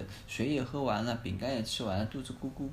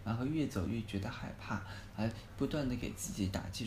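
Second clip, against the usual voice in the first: ordinary delivery, nothing marked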